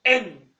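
"إن" said as the full name "en"; name the letter, vowel first: "إن". Shown as a demonstration. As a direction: say "إن" with its opening vowel. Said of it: "اسمه إيه؟".

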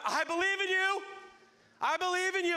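loud, very high cry of belief; shouted profession. Retold or imitated. Believer on the shore telling Jesus, shouting. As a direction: shout it like a fan from the sidelines. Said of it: "you can shout, you believe".

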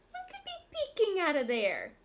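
normal talking voice

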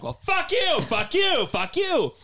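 hammed-up acting-class cursing, high then dropping; mock angry back and forth. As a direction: imitate a shouting match that winds down. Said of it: "one of the critiques of Meisner".

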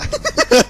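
squealing laugh; shrieking burst of laughter; high laugh. Real, not acted. Laughing at hit.

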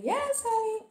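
very high squealed catchphrase imitation; Watson's catchphrase. Vlogger quoting her YouTuber friend's intro, high-pitched imitation. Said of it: "and it's me".

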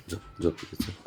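soft shushing whisper, lulling; whispering the dog to sleep; hushed whisper. Real, not acted. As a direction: whisper softly to settle the pet to sleep. Hushing pet to sleep.

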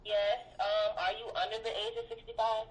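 usual speaking voice

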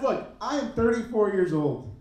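normal talking voice

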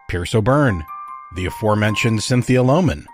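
plain reading voice

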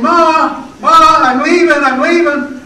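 loud call up the stairs, pitched high; retold son calling through the house; calling out goodbye. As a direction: call up to mother before leaving.

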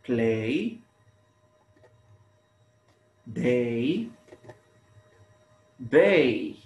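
play, day, bay, isolated with drawn-out endings; highlighted rhyme. Shown as a demonstration. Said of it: "Notice the similar sound at the end".

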